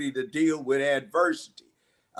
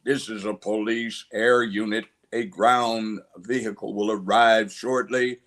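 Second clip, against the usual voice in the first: police announcer; 2 semitones lower than usual. Acted. Police air unit ordering a man outside, official announcement.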